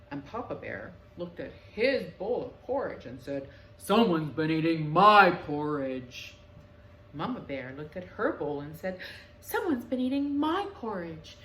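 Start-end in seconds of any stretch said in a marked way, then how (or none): 3.8-6.3 low, loud, slow big bear; lower, booming papa bear. A narrator's reading. Papa Bear voiced in story. 9.4-11.4 higher, louder mother bear; a brisk higher mama bear; raised mama bear voice. A narrator's reading.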